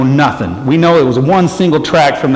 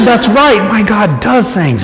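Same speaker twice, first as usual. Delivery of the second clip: proud reply; 8 semitones higher than usual. Retold or imitated.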